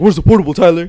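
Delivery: loud, high teasing holler; taunting call. Retold or imitated. Acting out how they taunted Tyler.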